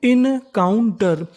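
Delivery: Encounter slowly split as in-kaun-tar; syllable by syllable. Shown as a demonstration. Teacher assembling a word for learners, syllable by syllable. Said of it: "तो meaning बनी".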